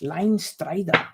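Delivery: slow, hammed-up German accent. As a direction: draw it out in mock German. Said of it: "German accent".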